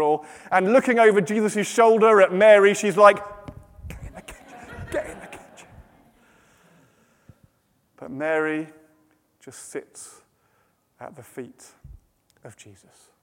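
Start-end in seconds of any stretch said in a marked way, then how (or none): 3.9-5.7 sharp voiceless whispered order; urgent whisper. Acted. Martha secretly ordering Mary behind Jesus' back. 8.0-13.1 soft, low, long pauses; serene hush. A narrator's reading. Serene refrain against Martha's frantic rush.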